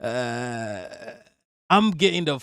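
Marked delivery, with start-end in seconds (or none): 0.0-0.8 low, very soft creaky "uh"; vocal fry groan. Retold or imitated. Streamer showing the sound he would run from, creaky groan. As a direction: imitate a drawn-out vocal fry. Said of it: "a vocal fry".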